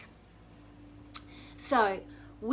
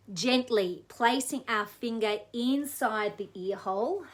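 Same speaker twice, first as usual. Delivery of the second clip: ordinary delivery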